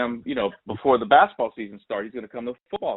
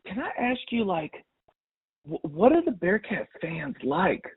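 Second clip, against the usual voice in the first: a higher, unhurried, probing mom; curious mom; 9 semitones higher than usual. Retold or imitated. Mom voiced, curious question.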